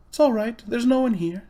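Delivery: put-on high, light girl's voice; young girl calling out gently. A narrator's reading. Polly reassures Diggory that the room is empty, high girl's voice. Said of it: "She was speaking above a whisper now".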